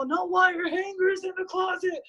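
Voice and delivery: high, slow, hammed-up movie mother; Mommie Dearest quote. Quoting the movie mother, slow and high. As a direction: drawl it like a crazed film mom. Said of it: "Mother May I from the, from that movie".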